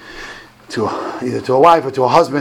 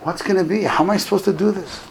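put-on higher-pitched, mildly pleading boy; retold tearful plea; 6 semitones higher, 4 dB quieter than usual. Boy crying to the rabbi, mock tearful plea. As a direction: lightly act out a child's upset plea. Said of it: "The boy starts crying to Rav Steinman".

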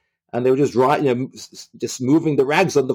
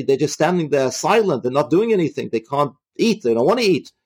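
usual speaking voice

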